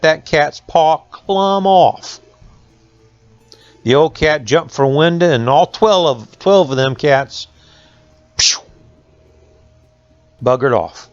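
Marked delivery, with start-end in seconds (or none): none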